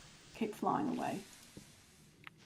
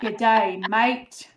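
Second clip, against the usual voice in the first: louder, cheerful Australian "good day, mate"; echoed Australian greeting; 10 dB louder, slower than usual. Retold or imitated. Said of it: "That was perfect".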